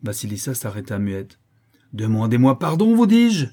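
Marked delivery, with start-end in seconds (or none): 1.9-3.5 loud shriek climbing into falsetto; falsetto rage. A narrator's reading. Countess repeats her demand in excess of anger, shrieking falsetto. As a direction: insist furiously, voice shooting up high. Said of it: "détonnant en fausset dans l'excès de sa colère".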